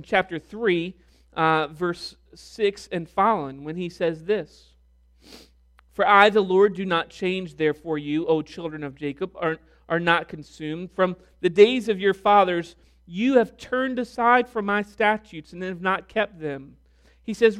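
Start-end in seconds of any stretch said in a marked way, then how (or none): 5.9-16.8 declaiming God's words, rising in force; scripture reading. Acted. Preacher reading the Lord's words aloud to the congregation. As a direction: read it as the Lord speaking with authority.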